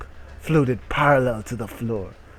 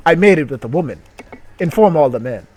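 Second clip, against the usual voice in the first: boastful proclamation; 4 semitones higher, 9 dB louder, faster than usual. Pretend. Boastful character exclamation.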